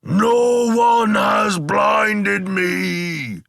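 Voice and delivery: high, loud, drawn-out wail of pain; wailing cry. A narrator's reading. Blinded Cyclops crying out to his brethren, slow high wail. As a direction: howl it slowly to the other giants. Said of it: "for he wailed".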